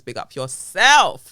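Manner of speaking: big, slow, loud shout-out. Real, not acted. Shouting out Ashanti over the mic, loud and slow. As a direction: hail the listener like a DJ.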